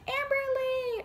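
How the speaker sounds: high, delighted shout of the name Amberly; excited call. Retold or imitated. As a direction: call out like an overjoyed small kid. Little friend greets her excitedly.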